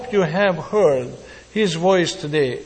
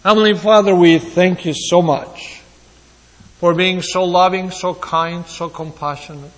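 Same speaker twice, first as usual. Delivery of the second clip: prayer; 2 semitones lower than usual. Acted. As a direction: pray it tenderly to the Father. Leads closing prayer.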